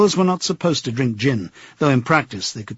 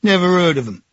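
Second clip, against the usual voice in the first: curt; 6 semitones higher, 4 dB louder, faster than usual. A narrator's reading. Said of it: "said the barman shortly".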